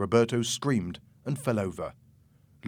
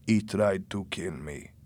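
slow, lowered character voice; accusing Jose; 3 semitones lower, 3 dB quieter, slower than usual. A narrator's reading. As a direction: state the accusation slowly and low. Wounded Jose accuses Louis.

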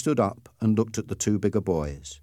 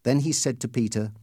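plain reading voice

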